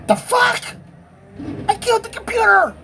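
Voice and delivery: real alarm at the dead computer; shocked outburst. Real, not acted. Computer pops and dies.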